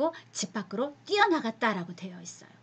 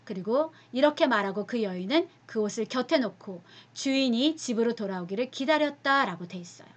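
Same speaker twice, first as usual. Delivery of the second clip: ordinary delivery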